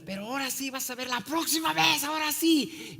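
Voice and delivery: high-pitched threat called after someone; mock a bully's yell without full volume; high, taunting retold shout; imitated threatening yell. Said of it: "me grita".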